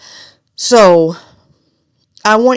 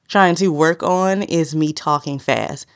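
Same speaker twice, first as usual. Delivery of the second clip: normal talking voice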